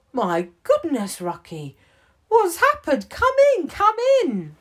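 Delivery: high, loud flustered concern; alarmed welcome. Acted. Gibbons finds Rucky crying at the door, high and alarmed. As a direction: gasp and hurry him inside.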